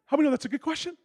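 normal talking voice

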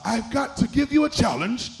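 put-on English accent of a TV chef. Chef setting contestants a challenge, mock English accent. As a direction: imitate Gordon Ramsay's English accent. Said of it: "Don't laugh at my English accent".

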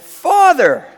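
high, very loud call for help; yelled cry. Retold or imitated. Driver crying to God while skidding, loud high yell. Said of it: "like that".

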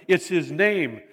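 ordinary talk, nothing marked

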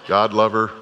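ordinary delivery

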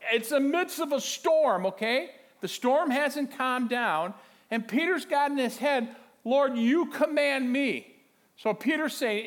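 loud, high, excited preacher delivery; full-force preaching. Acted. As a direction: preach it out with rising urgency.

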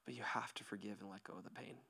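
soft, whispered comfort to the hurting; hushed whisper. Real, not acted. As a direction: lean in and whisper it gently. Tender altar-call moment.

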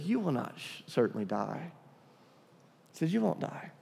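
hushed devil's lie. Retold or imitated. Devil's quiet lie, retold.

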